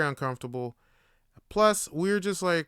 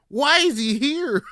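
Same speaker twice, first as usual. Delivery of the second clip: loud, high, slow; shocked shouting; 12 semitones higher, 9 dB louder than usual. Retold or imitated. Asking the foreman why the old man works there, loud disbelief. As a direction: demand it in disbelief. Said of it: "I'm like".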